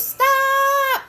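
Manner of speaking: high, loud, drawn-out yell; mock shouted stop. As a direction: holler it out as if halting someone. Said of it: "until somebody goes".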